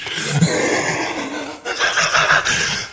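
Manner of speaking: unvoiced giggle; stifled laugh. Real, not acted. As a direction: laugh under the breath.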